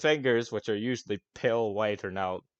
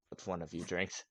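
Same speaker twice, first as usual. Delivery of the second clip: usual speaking voice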